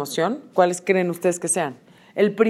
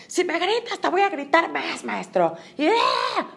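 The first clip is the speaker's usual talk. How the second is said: explotar; 8 semitones higher, 4 dB quieter than usual. Acted. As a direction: act out blowing up in a strained high voice. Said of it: "Explotar es". (explosión de enojo fingida, en voz muy aguda)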